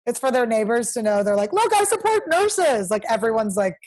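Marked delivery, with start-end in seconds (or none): 1.5-2.9 high, slow preachy mockery; hammed-up virtue signaling; mock self-righteous. Mocking neighbors' virtue signaling.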